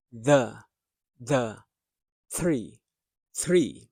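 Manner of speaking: "the" and "three" as isolated words, th made on the tongue. Shown as a demonstration. Teacher showing the tongue position, deliberate TH. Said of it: "The most important feature in pronouncing this T".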